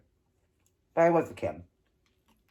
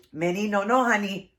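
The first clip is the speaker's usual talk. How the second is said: higher, louder telling-off; calling out a scolding; 6 semitones higher, 5 dB louder than usual. Real, not acted. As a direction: break off and call a firm no. Breaks off to scold Minnie.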